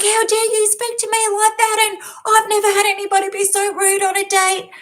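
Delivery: high, indignant mock rant; imitated outrage. Narcissist date blowing up at her criticism, high mock outrage. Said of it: "He blew up like a bomb".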